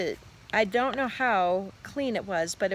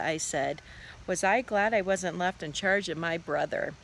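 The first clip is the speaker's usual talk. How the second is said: ordinary delivery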